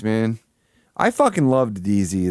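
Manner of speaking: ordinary talk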